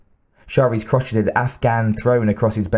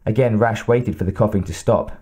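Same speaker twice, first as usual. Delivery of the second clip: ordinary delivery, nothing marked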